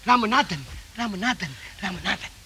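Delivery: ad-style echo of his own name; mock echo. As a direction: fade the name away like an echo. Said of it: "echo கொடுக்குறீங்க".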